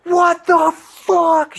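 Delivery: very high, loud, drawn-out shriek; shrill imitated scream of the girlfriend's sister; mock scream. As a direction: shriek it in shock. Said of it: "She starts screaming".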